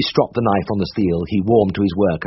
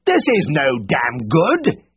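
exasperated shout; 5 semitones higher, much slower than usual. A narrator's reading. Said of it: "he shouted in exasperation".

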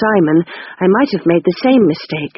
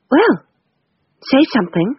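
put-on higher, pleading older sister; lightly voiced sister urging a reply; Rose, higher and urgent; Rose's voice; 7 semitones higher than usual.

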